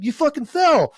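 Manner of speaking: imitated friend's high yell; loud, high shouted answer; shouting down. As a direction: holler it down from the roadside.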